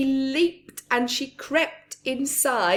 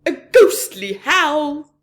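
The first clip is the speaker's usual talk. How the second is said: higher, much louder frightened cry; a robber crying out in fright; 6 semitones higher, 12 dB louder than usual. A narrator's reading. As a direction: cry out at the howl. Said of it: "said Len".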